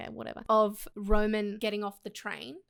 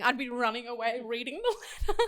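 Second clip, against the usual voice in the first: normal talking voice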